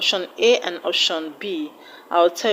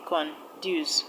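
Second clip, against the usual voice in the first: "conduce" split into con, then duce; teacher dividing "conduce" into its syllables; 2 semitones lower, 5 dB quieter than usual. Shown as a demonstration. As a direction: say "conduce" in two separate beats, softer. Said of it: "It's a two-syllabic word".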